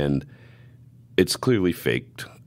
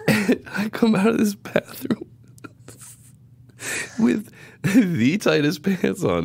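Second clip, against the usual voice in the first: laughing whisper; slower than usual. Real, not acted. Laughing through the story.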